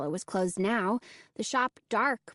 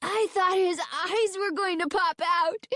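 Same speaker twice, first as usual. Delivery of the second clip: put-on high, gleeful boy's voice; gleeful exclamation; 8 semitones higher than usual. A narrator's reading. Alan gloating over the scared man, exclaiming gleefully. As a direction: squeal it with delight. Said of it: "Alan exclaimed gleefully".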